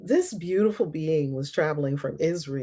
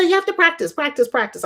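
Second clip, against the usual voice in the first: higher, lightly voiced insistent coach; a friend's coaching; 10 semitones higher, 4 dB louder, faster than usual. Israeli friend urging her to practice, lightly insistent. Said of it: "she said".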